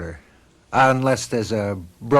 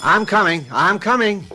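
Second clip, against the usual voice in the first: raised call toward the door; 7 semitones higher than usual. Acted. Husband calling to the visitor at the door, raised. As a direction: call to whoever rang. Answering the doorbell.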